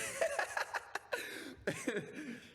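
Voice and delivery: high wheezing laugh; breathless laugh. Real, not acted. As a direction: laugh at the bad accent. Laughing at bad impression.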